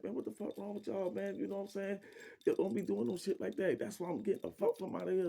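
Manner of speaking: mock-angry rant at the neighbors; cussing and fussing. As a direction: fuss at them for disrespecting the lady. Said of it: "Now I'm cussing and fussing".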